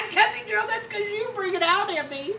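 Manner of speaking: high, laughing tease. Real, not acted. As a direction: laugh as you playfully pass the blame. Laughing tease back at friend.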